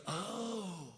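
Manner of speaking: hushed, drawn-out stoner approval; pot store clerk. Retold or imitated. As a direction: savor it slowly, soft and low.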